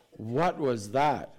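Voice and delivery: slow, drawn-out bewildered question; reliving the moment the blast hit; startled "what was that". Retold or imitated. After the explosion shook the window, a slow stunned question. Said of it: "And I was like".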